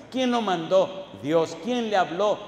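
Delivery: loud, rousing question-and-answer preaching; preaching at full force. Real, not acted. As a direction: hammer each answer out, building to a shout. (predicación enérgica, a plena voz)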